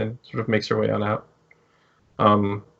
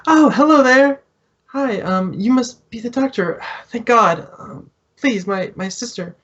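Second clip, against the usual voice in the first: high, bright-eyed relieved sister; relieved woman's voice; 11 semitones higher than usual. Acted. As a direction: pitch way up, gushing with relief. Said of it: "and she goes".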